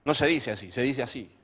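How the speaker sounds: pedantic corrector. Retold or imitated. Pepito corrects the study group, pedantic. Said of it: "Pero Pepito hablaba".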